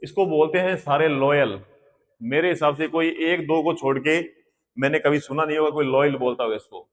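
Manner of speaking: usual speaking voice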